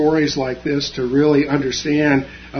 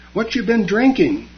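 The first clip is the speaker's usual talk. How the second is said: light question; 7 semitones higher than usual. A narrator's reading. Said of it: "he asked lightly".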